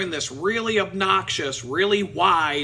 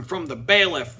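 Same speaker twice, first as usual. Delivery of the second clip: ordinary talk, nothing marked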